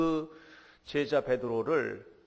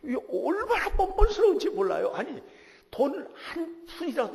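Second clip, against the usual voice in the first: exasperated outburst; 7 dB louder than usual. Real, not acted. Exasperated about his son.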